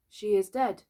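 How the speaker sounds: mildly uneasy statement; uncomfortable. A narrator's reading. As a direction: say it with a touch of discomfort. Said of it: "he said uncomfortably".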